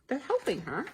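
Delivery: plain reading voice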